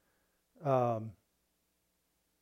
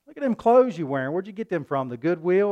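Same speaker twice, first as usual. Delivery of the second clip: mocking classmate; 4 semitones higher, 6 dB louder than usual. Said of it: "and people say".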